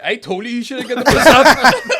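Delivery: high, loud mock dad gloating while laughing; laughing told-you-so. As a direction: ham up the smug dad through laughter. Dad's told-you-so quoted laughing.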